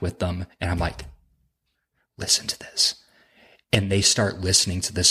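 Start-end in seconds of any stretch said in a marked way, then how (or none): 2.2-3.0 hushed aside to his friends; a sly, gleeful whisper to the buddies; conspiratorial whisper. Retold or imitated. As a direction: whisper it so the storyteller won't hear. Telling friends secretly during story.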